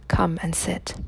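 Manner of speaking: soft unvoiced whisper. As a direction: breathe it, don't voice it. Woman invites her, hiding.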